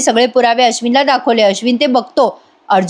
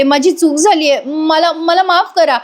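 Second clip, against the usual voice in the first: higher, slowing plea with a stammered start; imitated pleading; 5 semitones higher, slower than usual. Priya admitting her mistake, mock pleading. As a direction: act out begging for forgiveness. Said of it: "गयावया करायला लागते".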